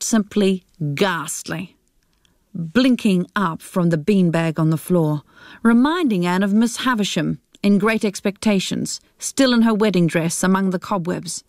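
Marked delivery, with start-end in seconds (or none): none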